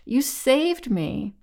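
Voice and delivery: high, slow, plaintive weeping; acted tearful plea, pitched well up. A narrator's reading. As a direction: stretch the words out tearfully. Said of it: "Doro wept".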